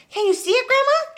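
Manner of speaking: high, loud eager question; excited shout. Acted. George shouts up to Grandma, high and eager. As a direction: call up to her. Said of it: "George shouted".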